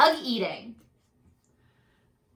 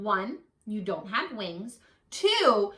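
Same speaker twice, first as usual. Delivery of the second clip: usual speaking voice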